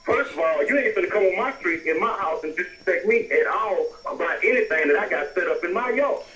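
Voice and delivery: ordinary delivery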